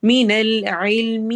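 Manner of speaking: slow tajweed recitation; recited slowly. Shown as a demonstration. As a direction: chant the phrase slowly. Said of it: "recites verse".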